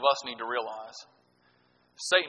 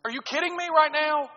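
disbelief; 11 semitones higher than usual. Retold or imitated. Kid in disbelief at teacher.

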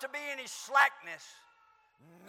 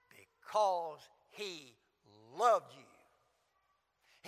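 slow emphatic refrain; 8 semitones lower, much slower than usual. Shown as a demonstration. Preacher driving home the refrain to the young people, slow and low. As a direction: stretch "Because he loved you" slowly, low and heavy.

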